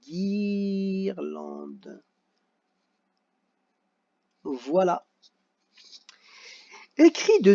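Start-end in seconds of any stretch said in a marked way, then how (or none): 0.0-2.0 "guirlande" with hard g and a long-held first syllable; drawn-out hard G word. Shown as a demonstration. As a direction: stretch the "guir" of "guirlande". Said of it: "pour marquer". (« guirlande » très étiré sur « guir »)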